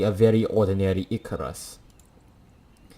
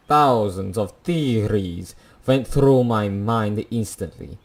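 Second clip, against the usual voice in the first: ordinary talk, nothing marked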